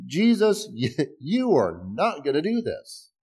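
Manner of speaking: high-pitched JESUS, then a slow "not gonna do this"; flatly refuse, stretching "you are not gonna do this"; acted, exaggerated insistence on NOT; very emphatic refusal. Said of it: "it's even more emphatic".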